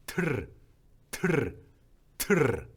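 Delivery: 트르 with a short trilled r; 트르 said with a quick rolled r. Shown as a demonstration. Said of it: "이번에는".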